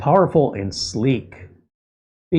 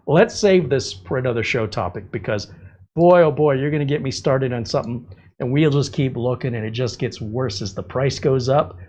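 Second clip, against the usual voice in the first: ordinary delivery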